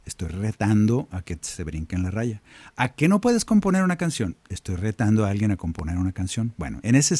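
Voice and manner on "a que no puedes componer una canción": mock dare. Shown as a demonstration. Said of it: "Es como decirle a la gente".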